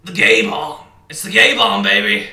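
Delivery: loud, high mock-rant shout; hollered catchphrase. Riffing on the conspiracy rant, gleeful loud hollering. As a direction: holler it like a crazed hype man.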